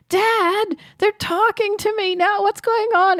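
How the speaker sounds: imitated frightened kid, very high-pitched; scared child. Grandson to his dad about talking tapes, mock-scared high voice. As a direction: play a small boy alarmed, calling out to Dad high up. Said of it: "he got all scared".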